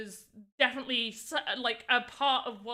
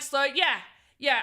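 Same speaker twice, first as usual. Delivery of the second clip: dismissive; 3 semitones higher, 8 dB louder than usual. Retold or imitated. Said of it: "I'm like".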